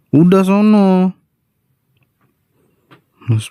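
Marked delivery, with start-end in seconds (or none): 0.1-1.1 huffy shoo. Acted. Sulky wife shoos husband.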